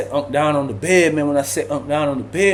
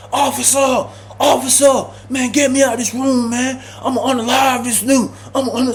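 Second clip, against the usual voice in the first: higher, shouting for the officer; panicked shouting; 8 semitones higher than usual. Retold or imitated. Ty begging the officer to get him out, shouting. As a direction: yell through the door to be let out. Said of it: "beating the door down".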